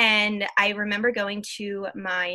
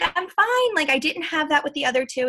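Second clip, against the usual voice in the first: high, louder insistence, easing down; retold protest; 7 semitones higher, 4 dB louder than usual. Patient telling doctor she is fine, retold protest. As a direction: imitate insisting from a very high pitch, then settle.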